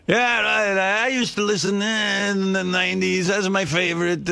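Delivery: put-on high, excited broken English in a thick Italian accent. Italian watch salesman quizzing a customer about his stylist, thick Italian accent. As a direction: imitate a fresh-off-the-boat Italian salesman, hammed up and high. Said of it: "he had some foreign accent".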